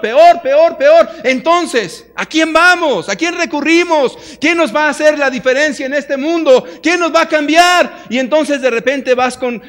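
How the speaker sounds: loud, high, impassioned sermon voice; rising pulpit fervor, driving each line home; full-force preaching. Acted. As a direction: preach it out to the crowd, building.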